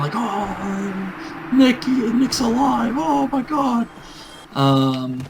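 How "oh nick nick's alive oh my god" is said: high, slow, hammed-up disbelief; mock freaking out. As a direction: squeal it like you just saw a ghost. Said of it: "everyone was freaking out".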